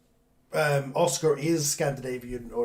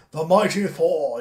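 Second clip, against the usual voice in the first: grand British hero voice, louder and higher; Thor; 4 semitones higher, 9 dB louder, slower than usual. Acted. Co-host imitates the traditional British Thor, slow booming proclamation. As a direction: proclaim it slowly and loudly, like a heroic god. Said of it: "British Thor voice".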